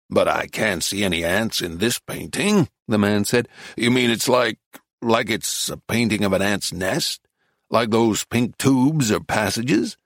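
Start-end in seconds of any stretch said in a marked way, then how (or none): 0.1-2.7 low-pitched sceptical tourist; puzzled husband, pitched down; doubtful American man. Acted. Reader plays doubtful American man. 3.8-10.0 tourist working it out; questioning American husband; puzzled American man. Acted. Reader plays American man.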